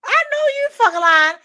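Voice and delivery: loud, high, disbelieving comeback; high incredulous retort. Retold or imitated. Retorting to her nephew, loud and high in disbelief. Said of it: "I said".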